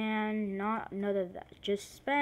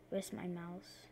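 ordinary talk, nothing marked

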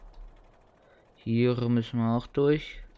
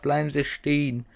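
louder, higher repeat of the order; repeated command; 5 semitones higher, 5 dB louder than usual. Retold or imitated. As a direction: insist like a cop. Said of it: "Ich hab so gesagt". (wiederholter Befehl, lauter)

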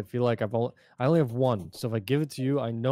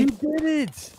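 high, loud shout of joy; excited cheer; 4 dB louder, much slower than usual. Real, not acted. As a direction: cheer it out big.

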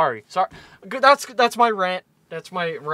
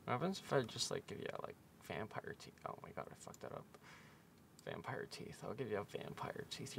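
breathy whispered musing to yourself; whisper to self; 9 semitones lower, 24 dB quieter than usual. Real, not acted. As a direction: think aloud in a whisper while drawing. Muttering to himself while drawing.

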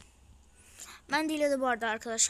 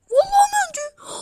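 high shrieking joy, far louder; ecstatic scream; 16 dB louder than usual. Real, not acted. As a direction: scream with disbelieving delight. Rare character pulled from reward. (sevinçten çığlık atarak)

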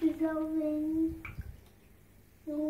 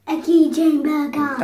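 loud; 14 dB louder than usual. Real, not acted. Loud excited correction.

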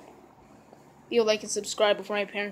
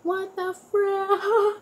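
shocked cry; 10 semitones higher, much slower than usual. Real, not acted. Reacting to sour candy.

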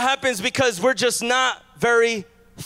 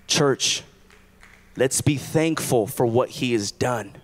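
hushed pastoral appeal; 11 semitones lower, 5 dB quieter than usual. Real, not acted. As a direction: draw the church in quietly.

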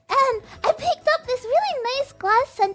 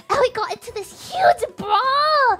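ordinary delivery

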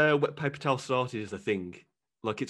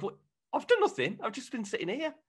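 much higher, innocent protest; mock chair voice; 11 semitones higher than usual. Chair denying blame to the kid, high and innocent. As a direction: play a wounded, innocent chair.

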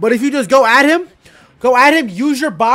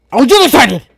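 defensive shout; 9 dB louder than usual. Real, not acted.

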